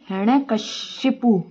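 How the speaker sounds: हिरण्यकशिपु spelled out slowly, syllable by syllable; हिरण्यकशिपु drawn out and stressed; slow careful pronunciation. Shown as a demonstration. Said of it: "हिरण्यकशिपु होता है".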